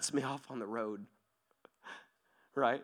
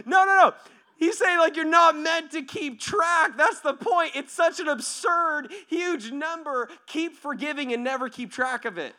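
high, loud, impassioned correction; fired-up preacher's shout; emphatic preaching; 10 dB louder than usual. Real, not acted. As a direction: drive the point home at full voice. Preacher loudly driving home the point.

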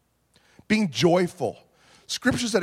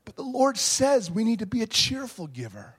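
usual speaking voice

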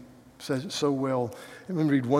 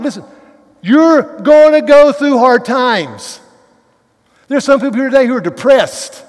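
loud, emphatic sermon delivery, louder and higher; passionate preaching; 6 semitones higher, 11 dB louder than usual. Acted. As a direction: preach it hard to the congregation. Passionate preaching full force.

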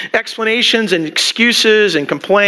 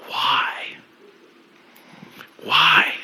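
ordinary talk, nothing marked

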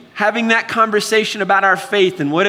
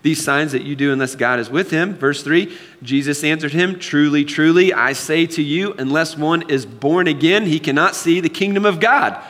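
measured, lower scripture reading; 4 semitones lower than usual. Acted. Pastor reading John to congregation, lower, measured reading.

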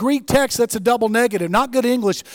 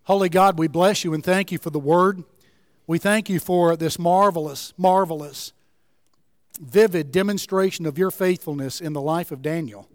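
closing prayer; 2 semitones lower than usual. Acted. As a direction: pray gently for the congregation.

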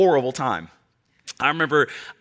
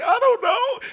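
put-on very high, louder crying child voice; bawling little boy; 3 dB louder, slower than usual. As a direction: wail it like a sobbing five-year-old. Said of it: "I was like".

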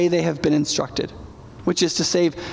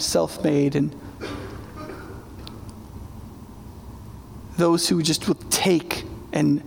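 pulpit prayer; 3 semitones lower, 3 dB quieter than usual. Acted. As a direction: pray humbly over the congregation, lower and softer. Leads the closing prayer.

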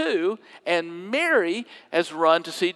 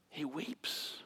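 whispered; 3 semitones lower, 16 dB quieter than usual. A narrator's reading. Preacher telling of Jesus weeping, in a whisper. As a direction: hush it as if at a graveside.

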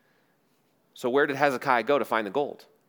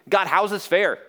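higher, louder cry of protest; questioning God; 7 semitones higher, 6 dB louder than usual. Acted. Jerusalem questions God over its fate, raised protesting voice. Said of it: "the whole city questioned God".

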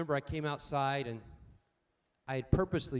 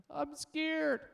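put-on scared little boy; 10 semitones higher than usual. Son stuck at top of tree.

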